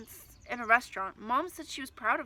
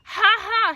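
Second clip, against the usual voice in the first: loud wild cackle, much higher; crazy laugh; 11 semitones higher, 14 dB louder than usual. Acted. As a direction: laugh crazily into the wind. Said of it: "he laughed crazily".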